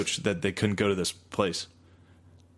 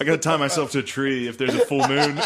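normal talking voice

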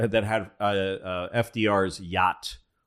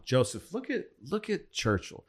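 higher, softer teasing voice; mocking Roosevelt; 7 semitones higher, 5 dB quieter than usual. Roosevelt to Stalin, mocking Churchill in a higher voice. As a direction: needle Churchill behind his back, pitched up. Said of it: "he says to Stalin".

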